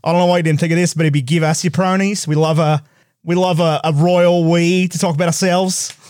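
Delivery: lightly imitated Australian; mock Australian accent, close to own pitch. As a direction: play a second Australian chiming in. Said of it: "another Australian person responded and was like".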